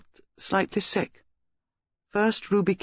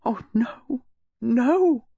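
high-pitched cry of denial; anguished mother's protest, pitched high; distraught outburst; 8 semitones higher, much slower than usual. A narrator's reading. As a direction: burst out in grief, refusing the news. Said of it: "Missus Reeves burst out".

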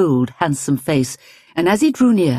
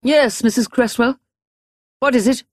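raised, sharp question; sharply; 8 semitones higher than usual. Acted. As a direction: snap the question out. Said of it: "she said sharply".

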